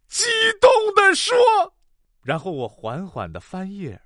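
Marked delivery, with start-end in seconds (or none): 0.1-1.4 high, tearful mock-impassioned cry; hammed-up recitation at fever pitch; emotional climax. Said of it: "我的情绪也到了最高潮".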